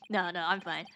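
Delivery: ordinary talk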